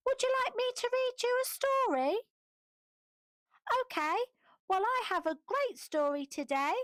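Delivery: ordinary delivery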